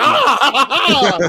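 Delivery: lightly voiced monster laughing off bullets; a hammed-up monster chuckle; mock villain laugh, shrugging off gunfire. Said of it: "the monster was just like".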